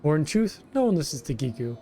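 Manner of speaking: whiny kobold. Acted. Kobold foreman Gigu complaining to the hero, wheedling. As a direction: play a self-pitying kobold pleading with a stranger.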